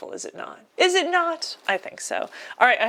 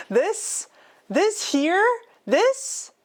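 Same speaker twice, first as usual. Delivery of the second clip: each this pitched higher, rising to a peak; building suspense; 9 semitones higher, much slower than usual. Real, not acted. Teasing chat before naming Mewtwo, rising higher. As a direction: climb in pitch toward the big reveal.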